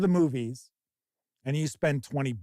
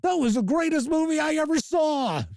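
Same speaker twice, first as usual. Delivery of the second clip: higher, baffled fan defending a movie; indignant disbelief; 10 semitones higher than usual. Retold or imitated. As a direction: protest like a wounded moviegoer. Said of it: "It's like".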